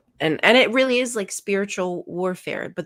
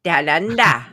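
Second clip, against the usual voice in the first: loud, hammed-up Australian accent on Down under; a bad mock Aussie twang; 7 dB louder than usual. Host hamming an Aussie accent for Australian guest, loud mock Australian. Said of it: "my terrible accent".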